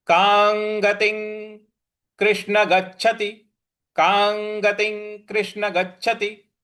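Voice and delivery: कांगतिं कृष्ण गच्छति slow with clear ng; careful recitation. Shown as a demonstration. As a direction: model the line carefully. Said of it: "इस प्रकार से इसका उच्चारण होगा".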